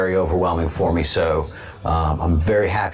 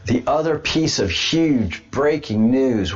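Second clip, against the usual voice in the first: usual speaking voice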